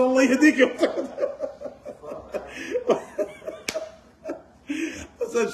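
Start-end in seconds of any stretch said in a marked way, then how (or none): 0.0-0.6 exasperated retort. Retold or imitated. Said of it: "And I said". 0.6-3.9 breathless high-pitched laugh; airy high giggle; high wheezing laugh. Real, not acted. Laughs at his own story.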